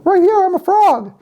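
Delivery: very high falsetto little frog; frog princess voice. Acted. Frog redirects the searching boy, high falsetto. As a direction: call up in a squeaky frog voice. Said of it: "he hears his voice".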